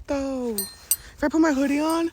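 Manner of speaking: ordinary talk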